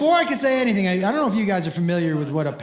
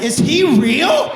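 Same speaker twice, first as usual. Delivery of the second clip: high, slow, loud yelp of disbelief; loud, drawn-out disbelieving cry; incredulous shout; 7 semitones higher, 7 dB louder, slower than usual. Acted. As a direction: blurt it out in shocked panic. Panicked dad blurts question.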